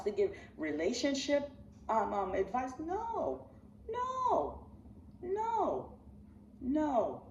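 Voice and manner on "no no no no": each no higher and sharper; rising protest; escalating no. Real, not acted. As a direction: insist with climbing high noes. Emphatic denial, rising pitch.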